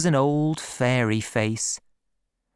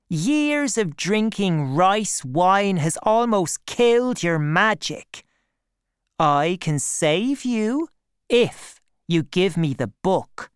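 lightly voiced negotiator, pitched higher; higher, assured dealmaker voice; young schemer, bargaining; 6 semitones higher than usual. A narrator's reading. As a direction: firmly press a bargain.

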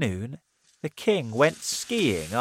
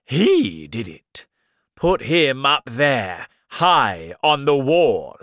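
slow, loud, harsh royal order; a cruel king condemning a servant; angry command; 4 semitones higher, 10 dB louder, slower than usual. Acted. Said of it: "and he said".

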